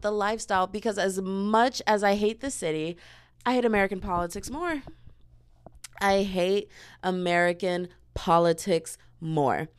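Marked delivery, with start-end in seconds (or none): 6.0-9.7 deliberate, word by word; measured, weighty repetition; slow emphatic. Real, not acted. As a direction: spell it out slowly, pausing between words. Repeats statement slowly for emphasis.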